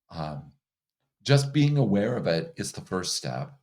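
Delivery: normal talking voice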